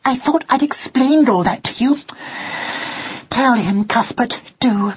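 high, exasperated mother mouse; sharp, high-pitched impatience. A narrator's reading. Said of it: "in her sharpest voice".

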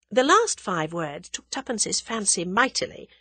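ordinary delivery, nothing marked

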